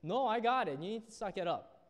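loud, firm mentor; mentor insisting. Retold or imitated. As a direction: hammer it home like a blunt mentor. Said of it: "He's like".

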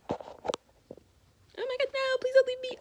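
loud, high playful begging; hammed-up plea to the bunny; mock pleading cry. Real, not acted. Begging her bunny not to hop away, loud and playful.

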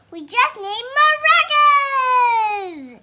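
high, loud, slow triumphant cry; drawn-out gleeful shout; excited shout. Acted. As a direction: belt it out like a big idea. Story climax line read with gusto.

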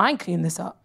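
low, soft aside of refusal; muttered refusal. Acted. Comic advising a politician to step aside, low soft refusal. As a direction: drop it low and refuse under your breath.

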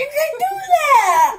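high and loud, full of laughter; laughing. Real, not acted. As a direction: say it while laughing hard. Both cracking up.

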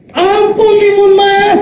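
high, hammed-up plea for mercy; mock begging. Ogre begs Timun Mas for mercy, mock wailing. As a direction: wail it like a beaten ogre in a game.

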